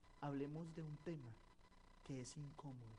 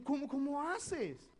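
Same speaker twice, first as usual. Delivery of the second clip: amazed question; 9 semitones higher, 9 dB louder, slower than usual. Retold or imitated. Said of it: "Y yo".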